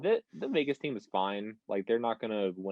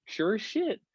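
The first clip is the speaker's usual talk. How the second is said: ordinary talk, nothing marked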